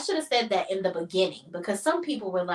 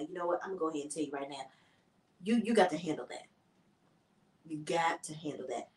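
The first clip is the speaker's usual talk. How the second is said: low, soft, halting voice; hushed imitation of a husband breaking bad news; mock-dramatic confession; 5 semitones lower, 10 dB quieter than usual. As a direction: play a grave, reluctant confession. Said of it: "He's very dramatic".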